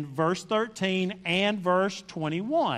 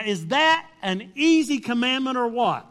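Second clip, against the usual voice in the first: normal talking voice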